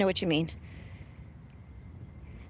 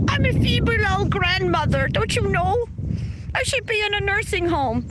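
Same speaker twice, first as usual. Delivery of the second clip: granny voice; 7 semitones higher than usual. Pretend. Mocking a troll who called her granny with a higher old-lady voice. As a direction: play the feeble old grandmother for laughs. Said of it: "It's Granny".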